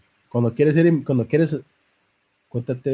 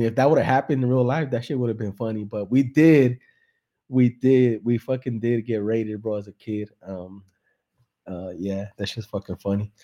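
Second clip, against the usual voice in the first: ordinary talk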